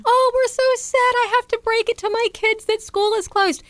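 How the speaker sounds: high whiny mock parent. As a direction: mimic a moaning parent. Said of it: "I saw people who were like".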